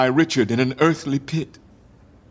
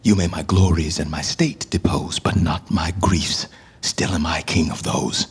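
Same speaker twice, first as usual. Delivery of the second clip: low, breathy half-whisper of a broken king; hushed grief; 11 semitones lower than usual. Acted. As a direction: let the words sink to a whisper. Richard clinging to his griefs.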